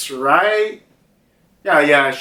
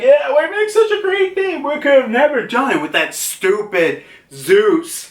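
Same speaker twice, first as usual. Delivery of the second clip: very high-pitched cartoon voice; squeaky boasting about the new team; gloating cartoon homie; 11 semitones higher than usual. Prometheus gloats to Hera about teamwork, gloating.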